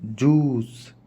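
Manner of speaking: जूस said slowly as "joos", no y glide; correct pronunciation, slow. Shown as a demonstration. Teacher modelling the right way to say juice. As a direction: stretch out जूस without a y sound. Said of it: "फिर है जूस".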